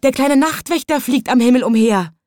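flower woman telling people, a touch alarmed; alarmed call. Acted. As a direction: call out in mild dismay. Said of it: "rief die Blumenfrau".

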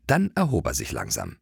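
ordinary delivery

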